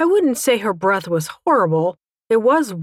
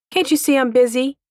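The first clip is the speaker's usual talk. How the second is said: higher-pitched, mildly irritated scolding; snapping; 6 semitones higher than usual. A narrator's reading. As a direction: scold an interrupting child. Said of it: "she snapped in response".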